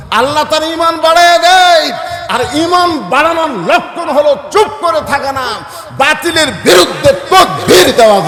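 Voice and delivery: loud, high-pitched sermon cry; fiery preaching. Real, not acted. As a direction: preach it at full force to rouse the crowd. (গলা ছেড়ে জ্বালাময়ী ওয়াজ)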